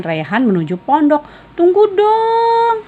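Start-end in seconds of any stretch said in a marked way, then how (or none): 1.5-2.8 very high, slow whiny plea; pleading. Acted. Rehan begs Fadil to wait, high and whiny. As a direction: drag it out, begging. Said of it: "pinta Rehan lagi".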